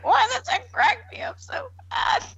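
whispered through laughter; laughing whisper. Real, not acted. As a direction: squeak it out laughing. Laughing fit.

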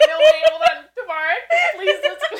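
laughing plea. Real, not acted. Begging friend to hear story.

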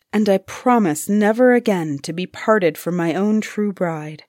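ordinary delivery

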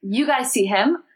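normal talking voice

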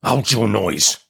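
rough convict's sudden threat; terrible voice. A narrator's reading. Man starting up among the graves at a small boy, terrible voice. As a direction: order the child silent in a terrible voice. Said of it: "cried a terrible voice".

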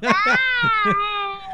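high squealing laugh. Real, not acted. Laughs at being teased.

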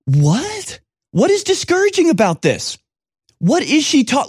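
What? said high, as if it makes no sense; incredulous. Real, not acted. Commentator reacts to the gymnast's complaint, high incredulous disbelief.

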